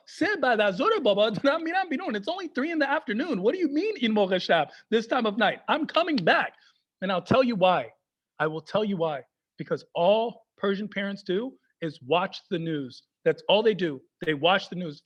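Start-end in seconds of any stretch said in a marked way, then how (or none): none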